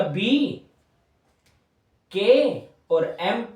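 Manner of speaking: each letter sound said slowly and apart; slow sound-by-sound spelling. Shown as a demonstration. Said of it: "के और एम की आवाज आ रही है". (धीरे-धीरे एक-एक ध्वनि)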